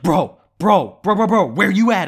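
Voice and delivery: loud, high frenzied bro after bro; mock panic. Friend calls in a staged emergency, hammed-up panic. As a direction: act out a friend frantic on the phone. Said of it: "He's in, like, a panic".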